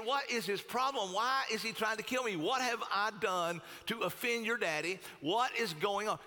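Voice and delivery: high-pitched, bewildered questioning; agitated protest. Acted. David asks Jonathan why he's being hunted, agitated.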